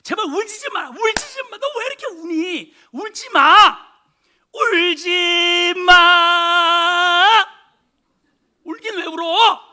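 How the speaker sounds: put-on high, loud pleading mother begging the baby to stop; desperate hushing. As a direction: cry out the last 울지 마 long and stretched, frantic. (애원하듯 높고 크게 외치는 엄마 목소리)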